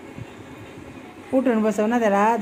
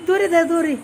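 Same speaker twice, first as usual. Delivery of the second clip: ordinary talk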